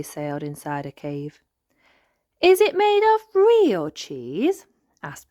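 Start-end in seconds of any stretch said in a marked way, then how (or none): 2.4-4.7 high, slow, wondering little piglet; a small girl asking in amazement; Peppa voice. Acted.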